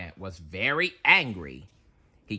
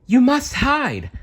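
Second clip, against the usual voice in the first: high, loud urgent cry; the harp's high, loud urgent warning; 6 dB louder than usual. Acted. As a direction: warn them to hide, high and urgent. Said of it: "cried the golden harp".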